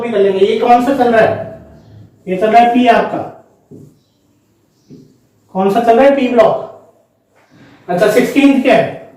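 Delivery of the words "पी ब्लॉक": ordinary talk, nothing marked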